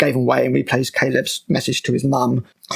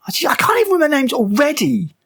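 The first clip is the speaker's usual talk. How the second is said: much higher, mock-exasperated about forgetting names; self-mocking exasperation; 10 semitones higher than usual. Real, not acted. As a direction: throw up your hands at your own memory, voice pitched way up.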